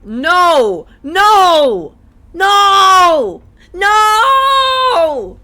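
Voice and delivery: rising desperate shout. Real, not acted. Reacts to Uwu voice redemption.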